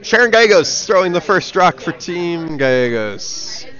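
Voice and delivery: upbeat sportscaster calling the throw; play-by-play commentary. Acted. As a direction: announce it like live curling coverage.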